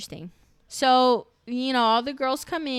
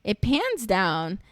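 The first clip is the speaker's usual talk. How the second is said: drawn out slowly; 3 dB louder, much slower than usual. Acted. Repeats the camera pan slowly.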